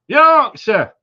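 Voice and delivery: Yorkshire shouted high and loud in broad Yorkshire; mock Yorkshire accent. Greeting a viewer in Leeds, in a loud mock Yorkshire accent. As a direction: bellow Yorkshire like a proud local. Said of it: "Sorry about my accents".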